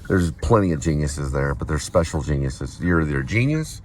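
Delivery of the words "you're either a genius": ordinary talk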